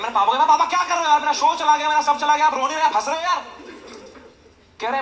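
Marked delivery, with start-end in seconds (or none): 0.5-3.5 higher, louder exasperated complaint; exasperated whine. Retold or imitated. Son complaining to his dad, who is laughing while the show is gone, in loud exasperation. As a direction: plead in comic frustration.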